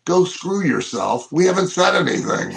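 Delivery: mild mock frustration, pitch climbing; frustrated crowd. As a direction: imitate a fed-up crowd answering back. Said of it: "they're getting frustrated".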